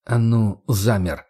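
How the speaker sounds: ordinary delivery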